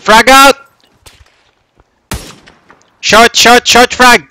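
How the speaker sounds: high loud urgent yell; combat callout. Real, not acted. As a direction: shout the grenade warning. Grenade throw in firefight.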